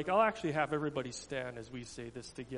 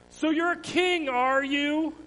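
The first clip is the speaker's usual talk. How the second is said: higher mocking taunt; jeering; 12 semitones higher, 10 dB louder, slower than usual. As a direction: sneer at him like a Roman soldier. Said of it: "the Roman soldiers jeered".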